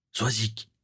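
ordinary delivery

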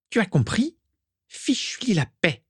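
high, venomous order; spitting. A narrator's reading. Isalia warning Mimi off Régulus, spitting. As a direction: spit it out in a high-pitched voice. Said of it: "cracha Isalia".

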